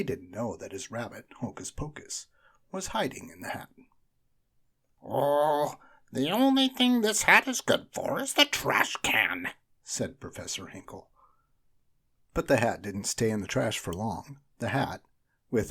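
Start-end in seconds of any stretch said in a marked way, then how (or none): 5.0-9.5 high, loud magician fed up; disgusted magician. A narrator's reading. Magician giving up on his useless hat. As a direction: toss the hat away in disgust.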